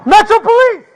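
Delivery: high, forceful police commands; shouted surrender demands. Real, not acted. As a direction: bark the orders at the suspect. Police ordering suspect out.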